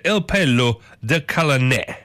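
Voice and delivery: put-on drawn-out amateur Italian accent; slow stab at an Italian accent; attempted Italian accent. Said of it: "get better at these accents".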